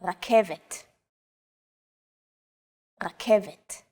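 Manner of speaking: Rakevet with the Hebrew R made at the back of the throat. Shown as a demonstration. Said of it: "pronounced at the back of the throat".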